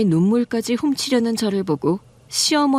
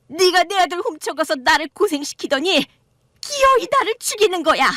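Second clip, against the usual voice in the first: put-on bitter old woman rising to a higher shriek; mother-in-law blaming her son's wife for her cancer; accusing mother-in-law; 7 semitones higher than usual. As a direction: blame her, building higher.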